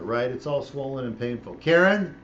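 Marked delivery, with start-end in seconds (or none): 1.5-2.1 raised, louder call to someone nearby; real call across to an assistant. Real, not acted. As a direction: call a colleague's name, higher and louder. Calls out to off-camera colleague.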